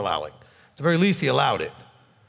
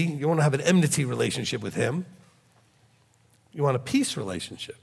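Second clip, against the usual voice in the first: ordinary talk, nothing marked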